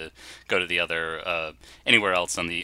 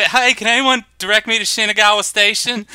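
put-on much higher, louder Texan tourist accent; 12 semitones higher, 9 dB louder than usual. Lost tourist asks strangers for the station in a Texan accent. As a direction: ham up a Texan tourist asking directions. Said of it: "I put on a Texan accent".

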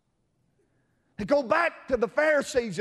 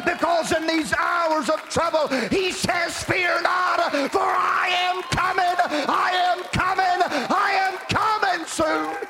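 preaching at full force; 6 semitones higher than usual. Acted. Preacher exhorting the congregation, full-force shouted preaching. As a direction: build the sermon to a shouting peak.

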